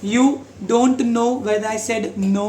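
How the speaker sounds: very slow, each word apart; slow example sentence. Shown as a demonstration. Teacher slowly modelling know and no. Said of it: "के N O W नो मतलब पता है".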